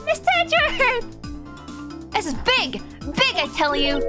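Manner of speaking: high, stretched eager call; bubbly young thief calling out; excited girl voice. Acted. Voicing Kay excitedly.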